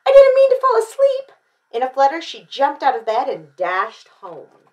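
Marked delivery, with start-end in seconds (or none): 0.1-1.3 very high, loud apology; panicked storybook excuse; flustered cry. A narrator's reading. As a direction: blurt it out in alarm. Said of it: "she cried".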